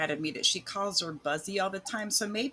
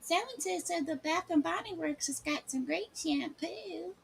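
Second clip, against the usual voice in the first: mocking quote; 10 semitones higher, 5 dB quieter than usual. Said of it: "she was talking about".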